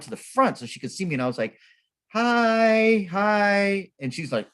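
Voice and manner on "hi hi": high, sustained "hi"; drawn-out friendly hail. Retold or imitated. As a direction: hail a stranger in a high, stretched call. Greeting a wary stranger from afar.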